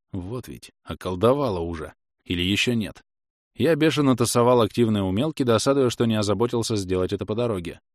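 usual speaking voice

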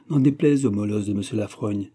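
usual speaking voice

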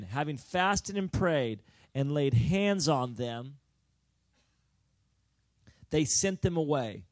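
slow, loud pulpit reading; loud, deliberate preacher reading; loud scripture reading. Acted. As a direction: proclaim the passage to the congregation.

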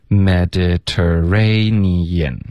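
very slow Mediterranean, every syllable held; teaching model. Shown as a demonstration. Teacher modelling the word for repetition, very slow and evenly drawn out. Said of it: "请老师教我们读一下".

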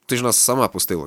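usual speaking voice

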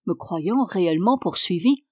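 ordinary delivery

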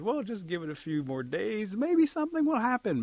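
high-pitched storybook coaxing; encouraging grasshopper. Acted. Grasshopper urging the bird to stay, encouraging. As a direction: lift the voice to coax a friend.

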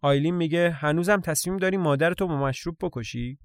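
ordinary delivery

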